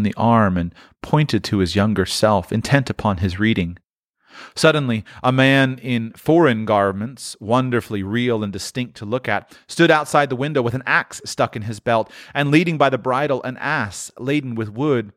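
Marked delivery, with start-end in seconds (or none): none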